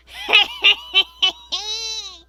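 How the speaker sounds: high, helpless howl of laughter. Acted. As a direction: roll on the floor laughing. Sid falls laughing.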